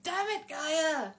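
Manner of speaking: high, loud, drawn-out comic outburst; mock exasperated yell. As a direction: stretch it out in playful frustration. Retold outburst at the card.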